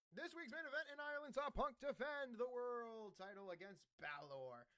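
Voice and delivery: high, projected main-event announcer voice; ring announcer. Pretend. Host introducing the main event to listeners, announcer voice. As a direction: announce the title fight, pitch held high, then dropping on the last name.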